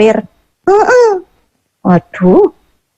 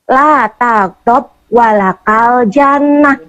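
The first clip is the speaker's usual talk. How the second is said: membaca hadis; much slower than usual. Acted. Membaca hadis dengan khidmat.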